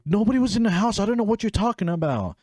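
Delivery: high, rushed mock denial; defensive. Denying it to mom, acted defensive. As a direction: protest innocence in a high-pitched voice. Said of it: "I'm getting so defensive answering to her".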